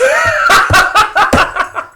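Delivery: helpless high-pitched burst of laughter; real laughing fit. Real, not acted. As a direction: crack up mid-thought, unable to carry on. Can't finish sentence for laughing.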